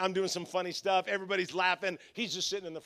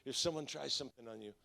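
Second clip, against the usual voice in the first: soft, low protective menace; Glenn; 5 semitones lower, 12 dB quieter than usual. Retold or imitated. Intimidating usher promising to protect his pastor, hushed low menace. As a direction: play a mobster making a quiet promise. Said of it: "he talks like this".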